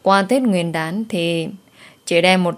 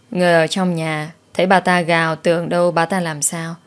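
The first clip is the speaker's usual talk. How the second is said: plain reading voice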